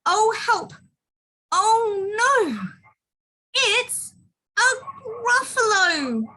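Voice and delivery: high, loud cry of dismay; terrified mouse. Acted. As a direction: cry out in hammed-up storybook fright. Mouse terrified at seeing Gruffalo.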